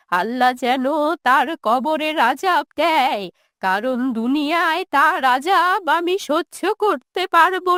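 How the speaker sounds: bitter, quavering lament of a wronged mother; wounded old woman, tearful and resentful; aggrieved old mother. Acted. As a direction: pour out the old grievance, stretching phrase ends.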